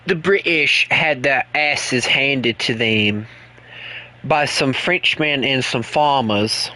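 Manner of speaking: slow, lightly put-on British accent; a slow English taunt, with a light British twang; a streamer teasing Chris in a slow mock British accent. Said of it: "mocking the British to Chris".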